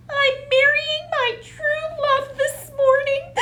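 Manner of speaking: a tiny weeping bride squeaking through tears; sobbing squirrel bride. A narrator's reading. Squirrel bride sobs to the children about her wedding, weeping. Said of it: "sobbed the squirrel bride".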